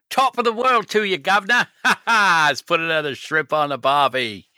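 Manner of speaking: high, hammed-up English-Australian accent; badly mix Cockney English with Australian; mangled English-Australian accent. Co-host greets partner with nonsense catchphrases, horrible English-Australian accent. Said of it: "So you've mixed horrible, uh, English and, and Australian accents".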